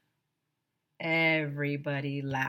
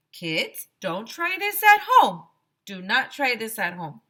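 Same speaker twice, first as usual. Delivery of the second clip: high, loud stern warning; louder, higher mock-serious warning; teacher warning; 4 semitones higher, 9 dB louder than usual. A narrator's reading. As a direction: warn the kids like a hammed-up teacher. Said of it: "she said".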